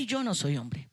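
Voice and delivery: ordinary talk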